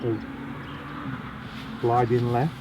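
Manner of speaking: speaking on held breath; tight, breath held while talking; holding breath. Real, not acted. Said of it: "I'm holding my breath".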